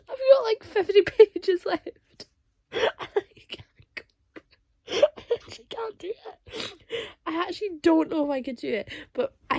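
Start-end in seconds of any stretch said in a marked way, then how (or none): 2.7-6.7 tearful. Real, not acted. Overwhelmed near book's end.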